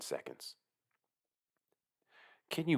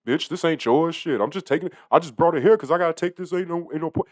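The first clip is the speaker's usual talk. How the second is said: irritated retort; 7 semitones higher, 8 dB louder than usual. Pretend. Imagined retort to customer.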